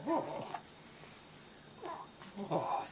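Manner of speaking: ordinary talk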